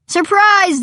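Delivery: high, loud surprise shout. A narrator's reading. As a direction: shout it like a party crowd. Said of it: "they all shout".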